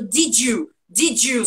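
"Did you" linked as "didju"; J sound. Shown as a demonstration. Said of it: "som de J".

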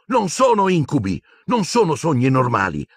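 urgent, heated pleading; angry boy convincing friends; frustrated insistence. A narrator's reading. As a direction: push hard, you must be believed.

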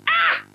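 very high startled squeak; loud squeak. Acted. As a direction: let out a tiny yelp. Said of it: "With a loud squeak".

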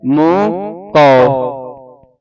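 ਮੋਹ ਕਉ drawn out very slowly, the mouth opening on ਕਉ. Shown as a demonstration. Teacher stretching ਮੋਹ ਕਉ for the student. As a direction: stretch ਮੋਹ ਕਉ and open wide on ਕਉ. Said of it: "ਇੱਥੇ ਕਉ ਤੇ ਮੂੰਹ ਖੁੱਲੇਗਾ".

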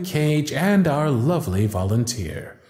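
high, projected countdown and reveal; showman announcement. Acted. Magician leading the audience countdown, rising showman excitement. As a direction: whip the crowd up to the big moment.

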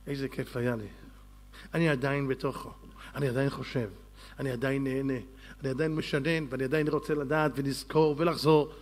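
low, soft voice lost in enjoyment; quiet savouring. Acted. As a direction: murmur it, as if still immersed in the learning. Savouring his Torah learning, acted.

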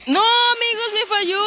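high loud frustrated cry. Real, not acted. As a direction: cry out in dismay. Game move failed. (lamento frustrado)

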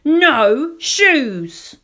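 put-on high, loud child's refusal; bellowed toddler protest; defiant shout. A narrator's reading. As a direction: yell it like a stubborn small child.

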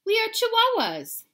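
high, loud little dog voice; squeaky chihuahua pup, proud. Acted. Pup announcing to the reader, high and loud. Chihuahua character line in story.

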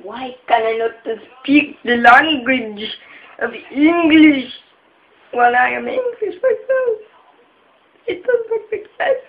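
rising, loud half-laughing wail; mock crying. Youtuber mock-sobbing about his own bad English, laughing through it. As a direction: ham up a sobbing breakdown.

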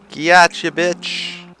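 high, loud gotcha; triumphant gloat. Real, not acted. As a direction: crow with victory. Triumph over cow.

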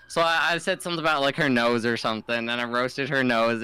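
ordinary talk

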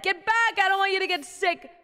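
loud, high mock shout; playful shout. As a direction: shoo them away playfully. Shooing chat away, sick.

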